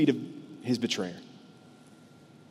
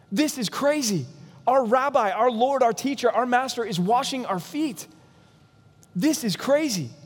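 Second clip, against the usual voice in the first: incredulous protest; 6 semitones higher than usual. Retold or imitated. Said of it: "Peter gives voice to what".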